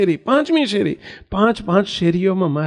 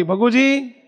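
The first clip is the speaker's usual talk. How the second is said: Swaminarayan calls his attendant at night, higher call; 6 semitones higher, much slower than usual. Acted. Maharaj wakes and calls for Bhaguji, a higher call. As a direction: call the name out across the room. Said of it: "બૂમ પાડી".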